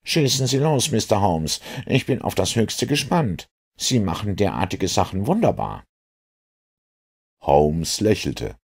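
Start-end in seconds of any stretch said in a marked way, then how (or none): 0.0-5.8 high, admiring inspector voice; eager inspector. Acted. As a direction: urge him on as the keen, high-voiced inspector. (gespannter, bewundernder Inspektor mit hoher Stimme)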